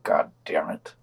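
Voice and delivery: under the breath, unvoiced curse; muttered whisper. Real, not acted. Host cursing under the breath after a jab at Catholics, whispered. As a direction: whisper it to yourself in frustration.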